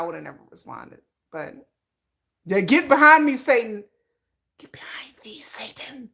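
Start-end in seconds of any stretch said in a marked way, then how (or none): 4.6-6.1 whispered rebuke, voice dropped away. Shown as a demonstration. Warding off men's devil energy, in a whisper. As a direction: whisper "Get behind me, Satan" like a warding prayer. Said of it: "repeats it whispered".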